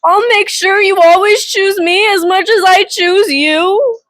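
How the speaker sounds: swooning reread of the quote. Retold or imitated. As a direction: savor the line like a love note. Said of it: "I'm just like".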